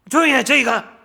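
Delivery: angry crowd driving off a beggar; scolding shout. Acted. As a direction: shout him down and send him away. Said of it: "꾸짖은 거예요".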